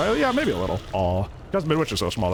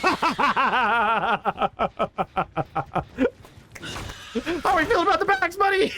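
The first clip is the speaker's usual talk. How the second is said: laughing; slower than usual. Real, not acted. Laughing at teammate bagging him.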